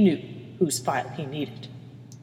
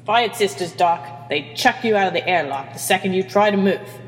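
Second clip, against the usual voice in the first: usual speaking voice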